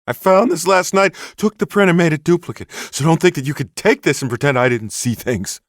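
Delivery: high, loud, indignant enthusiast voice; a worked-up crank laying down his proof; excitable Bigfoot hunter. Acted. Bigfoot hunter warns the sheriff he saw everything, high indignant enthusiast.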